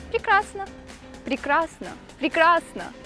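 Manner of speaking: normal talking voice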